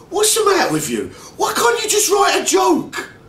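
put-on high, loud London-accented outburst, slowed on the second line; London twang shout. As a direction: yell it in disbelief with a London twang. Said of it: "He blurts out in his London twang".

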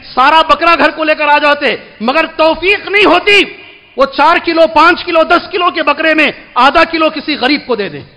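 forceful preaching. Real, not acted. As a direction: drive the point home from the pulpit.

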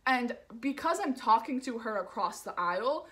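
plain reading voice

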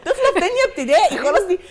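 loud, high laughing words; laughing while talking. Real, not acted. Mother laughing as she tells of the second grader. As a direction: push the words out through laughter.

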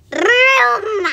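loud, high, drawn-out "brrroom"; playful vroom noise. Pretend. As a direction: rev it like a toy engine. Playful engine-like noise after broom.

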